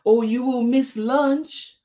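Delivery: high, loud warning call; friend calling out. A narrator's reading. Friend warning Tisha about lunch, high loud call. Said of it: "called her friend".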